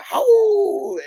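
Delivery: high, loud howled word; wolf howl. Pretend.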